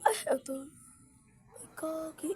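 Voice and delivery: soft whispered aside. Real, not acted. Adult whispering during play with child. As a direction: breathe it out in a whisper.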